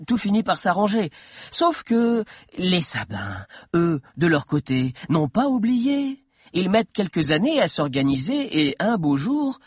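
theatrical storytelling with swinging intonation; playing with rhythm. A narrator's reading. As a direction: stretch key phrases, then hurry on. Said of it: "en jouant encore davantage sur le rythme, les silences et les intonations".

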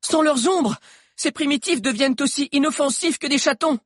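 high-pitched excited character voice; excited explanation. A narrator's reading. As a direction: share a thrilling discovery. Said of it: "fit Alix en battant des mains".

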